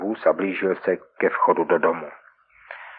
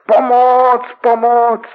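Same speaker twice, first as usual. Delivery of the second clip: much higher, much louder panicked scream; screaming for help; 10 dB louder, much slower than usual. Acted. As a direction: yell it again in terror. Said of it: "a pak začal zase křičet". (zoufalé volání o pomoc, mnohem výš a hlasitěji)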